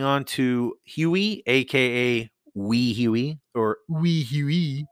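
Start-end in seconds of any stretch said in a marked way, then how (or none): 3.9-4.9 drawn-out, higher mock French accent. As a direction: say it slowly like a Frenchman. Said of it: "as the Frenchmen like to point out".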